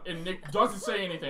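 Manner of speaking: normal talking voice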